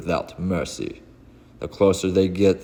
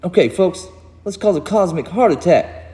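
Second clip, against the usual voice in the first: much higher, louder gung-ho call; hyped rally; 12 semitones higher, 6 dB louder than usual. Acted. Hero rallying the others before the blast, excited. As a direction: fire the team up. Hero rallies team to attack.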